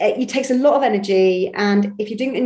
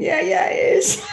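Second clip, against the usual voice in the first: high, drawn-out agreement through laughter; laughing agreement; 11 semitones higher, slower than usual. Retold or imitated. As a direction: laugh out an emphatic inner yes. Acts out agreeing thought, laughing.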